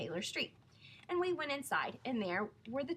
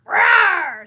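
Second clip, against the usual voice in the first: huge, high bellowing roar; loud lion roar; 11 semitones higher, 24 dB louder than usual. A narrator's reading. Lion roaring at the waiter for a marshmallow. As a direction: roar to get your way.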